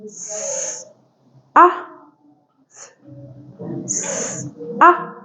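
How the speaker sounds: S hissed voiceless, ah voiced and open; teacher sounding out s, ah; blending phonics sounds. Shown as a demonstration. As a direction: sound out each letter for kids to blend. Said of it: "blend the three sounds".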